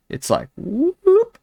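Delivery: a high, slow, swooping "whoop" imitating the curve of a nose; mock whoop sound. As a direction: slide the "whoop" way up and stretch it out. Mimicking a nose dipping back.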